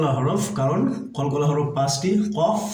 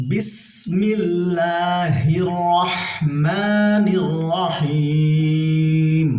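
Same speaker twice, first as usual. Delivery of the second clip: slow, drawn-out chanted recitation; tajweed recitation, long stretched vowels; Quran recitation; 3 semitones higher, much slower than usual. Shown as a demonstration. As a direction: recite it slowly and melodically. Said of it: "টেনে পড়তে হবে".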